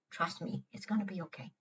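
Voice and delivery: calm a frightened friend quietly; tender heart voice. Shown as a demonstration. The heart answers the anxious self.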